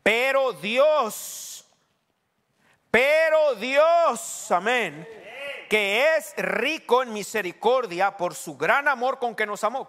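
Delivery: loud, hugely drawn-out proclamation; triumphant preacher building on a refrain; full-force preaching. Real, not acted. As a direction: stretch each key word and ring it out.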